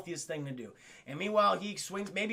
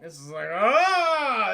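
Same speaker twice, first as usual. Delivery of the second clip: mock wail; 2 semitones higher, 8 dB louder, much slower than usual. Mock anguish over corpse.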